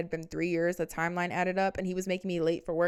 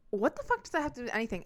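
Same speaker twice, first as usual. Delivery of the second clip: higher, fast, incredulous outburst; exasperated disbelief; 6 semitones higher, faster than usual. Retold or imitated. Reacting to the boyfriend's beer and coffee logic, fast incredulous outburst. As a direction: fire it off like you can't believe it.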